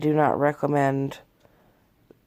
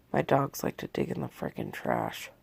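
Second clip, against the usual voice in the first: normal talking voice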